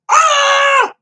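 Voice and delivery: sudden high shriek of alarm; brain shrieking. Retold or imitated. Coraline's brain spotting the needle, sudden high shriek. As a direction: let out a sharp high cry as it dawns. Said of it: "already her brain's going".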